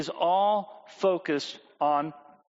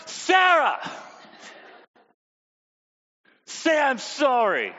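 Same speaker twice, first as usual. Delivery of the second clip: higher, louder exasperated demand; exasperated parent; 7 semitones higher, 4 dB louder, slower than usual. Retold or imitated. Parent exasperated that Sarah still won't apologize. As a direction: lose patience and insist.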